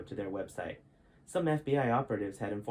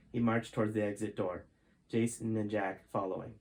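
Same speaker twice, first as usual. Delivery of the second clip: ordinary delivery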